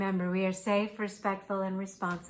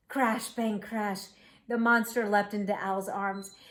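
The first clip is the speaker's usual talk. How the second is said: ordinary delivery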